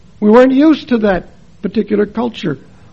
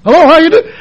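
louder, higher hello; loud greeting again; 7 semitones higher, 8 dB louder, faster than usual. Retold or imitated. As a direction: repeat it with full force. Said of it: "Say that again".

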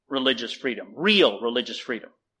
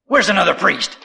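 eager challenge; 4 semitones higher, 7 dB louder than usual. Retold or imitated. Emboldened student wants another opponent.

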